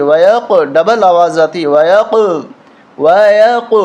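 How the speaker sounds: ویق with the ق bouncing like a ball off a wall; qalqalah bounce. Shown as a demonstration. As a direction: echo the ق with a sharp rebound. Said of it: "جھٹکے کے ساتھ پڑھیں گے". (قلقلہ کے ساتھ ویق)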